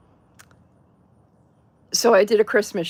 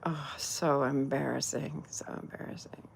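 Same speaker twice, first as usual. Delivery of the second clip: breathy whispered cringe; mortified hushed whisper; embarrassed whisper; 6 semitones lower, 13 dB quieter than usual. Retold or imitated. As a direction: murmur it in embarrassment under your breath. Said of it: "I was like".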